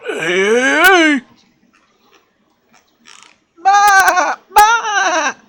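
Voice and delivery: high loud calls; shout. Retold or imitated. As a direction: call it out loud. Loud exclamations.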